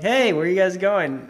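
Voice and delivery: loud, high call across the parking lot. Retold or imitated. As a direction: hail them from across the lot. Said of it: "And they're like".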